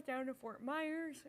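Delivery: slow words pulled by a yawn. Real, not acted. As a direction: say it through a yawn. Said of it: "I can't stop yawning".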